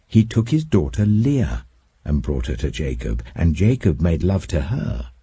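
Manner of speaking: ordinary delivery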